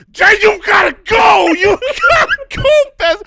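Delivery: very high, loud frantic yell; screaming. Retold or imitated. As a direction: scream at the driver in desperation. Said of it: "I'm screaming at him".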